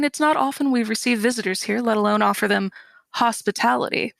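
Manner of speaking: quick, offhand aside; in passing. Retold or imitated. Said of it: "says, kind of in passing".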